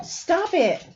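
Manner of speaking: high sharp "Stop it" to the dog; scolding the dog. Real, not acted.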